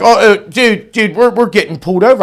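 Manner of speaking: high, loud and fast panic; panicked friend. Retold or imitated. Nervous friend sees the police car, fast and panicky. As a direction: blurt it out in a fluster.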